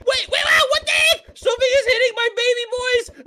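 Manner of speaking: high-pitched startled protest; alarmed outcry. Acted. Player defends his baby birds from an attacker, alarmed outcry. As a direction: cry out in sudden alarm.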